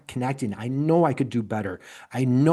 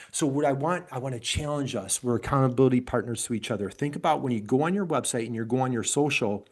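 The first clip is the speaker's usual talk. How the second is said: ordinary delivery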